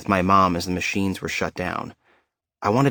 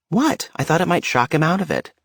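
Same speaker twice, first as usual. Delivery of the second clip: much higher, wide-eyed innocent protest; mock-innocent sister; 9 semitones higher than usual. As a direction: play the innocent little sister, pitched way up. Sister's innocent reply quoted.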